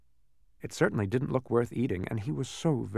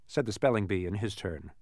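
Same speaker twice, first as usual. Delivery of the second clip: ordinary delivery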